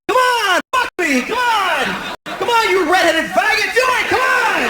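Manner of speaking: imitating a wild partner's high yells; demanding lover. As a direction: shout it like a pushy lover.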